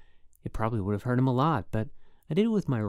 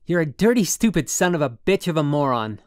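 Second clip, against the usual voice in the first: loud, higher, furious shouting; furious insult; 5 semitones higher, 11 dB louder than usual. A narrator's reading. Holden insulting his roommate, furious yell. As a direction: throw the insult right at him.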